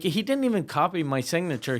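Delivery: ordinary talk, nothing marked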